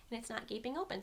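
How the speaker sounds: ordinary talk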